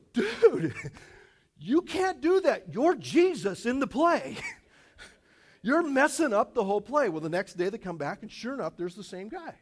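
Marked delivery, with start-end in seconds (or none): 0.1-7.1 much higher, louder mock boss shouting through laughter; boss yelling, laughing. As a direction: yell at the actor while cracking up. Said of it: "And the boss goes".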